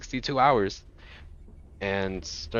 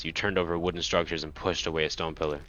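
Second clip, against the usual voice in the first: normal talking voice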